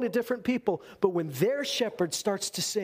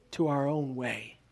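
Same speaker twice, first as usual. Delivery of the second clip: usual speaking voice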